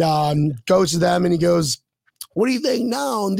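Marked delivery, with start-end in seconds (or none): 2.3-3.3 high, taunting mock devil; pissed-off devil. Devil in a joke taunting two guys, pissed off. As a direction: raise the pitch for a gloating challenge. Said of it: "Now the devil's pissed off".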